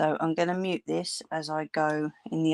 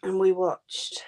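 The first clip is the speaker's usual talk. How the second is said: ordinary delivery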